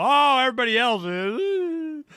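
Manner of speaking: high, loud whining mimic; hammed-up whimpering excuse; mock whiny excuse. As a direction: whine it like a sulky kid. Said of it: "Some people".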